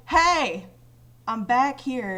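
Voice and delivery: loud shout; calling out. Retold or imitated. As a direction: yell to get their attention from behind. Calling out to the group.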